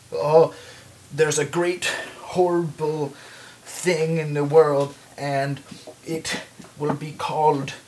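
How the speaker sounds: hammy French archaeologist reading a text; halting, portentous read-out in phrases; reading aloud. Acted. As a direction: read it out with mock drama, pausing often. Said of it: "he's reading it out loud".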